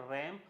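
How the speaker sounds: रैम with the vowel of cat; correct pronunciation. Shown as a demonstration. Teacher answers own question to children, front-vowel रैम. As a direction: say रैम rhyming with jam. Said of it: "इसका pronunciation है".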